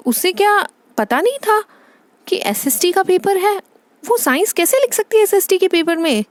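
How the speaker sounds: high-pitched baffled questioning; appalled mother on the phone; incredulous dismay. Acted. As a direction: question it in stunned disbelief. Mother stunned by teacher's news.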